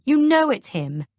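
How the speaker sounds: high, loud exasperated shout; frustrated shout. A narrator's reading. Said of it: "I shout in frustration".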